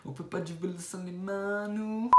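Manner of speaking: imitating the girl. Re-quoting the girl in the clip.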